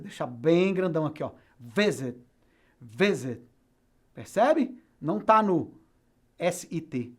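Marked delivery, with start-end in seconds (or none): 1.6-3.4 "Visit" stressed on the first syllable, VI-sit. Shown as a demonstration. Teacher repeating for students, stress on VI of "Visit". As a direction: punch VI, let -sit fall away in "Visit". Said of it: "a força tá no vi".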